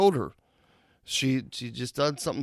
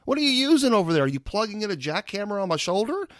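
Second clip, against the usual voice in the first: mock protest to therapist; 7 semitones higher, faster than usual. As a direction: retell the pain with mock indignation.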